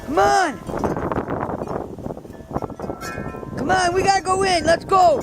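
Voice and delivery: high, carrying call to the dogs. Real, not acted. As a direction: call the dogs from across the yard. Calling dogs across snowy yard.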